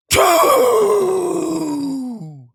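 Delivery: loud high shout. Acted. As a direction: blurt it out like a sneeze. Sneeze joke outburst.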